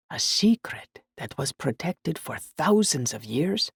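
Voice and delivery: slow, hushed secret-telling; mysterious whisper. Acted. Glassblower teasing a child with mystery, slow whisper. Waved his fingers mystically.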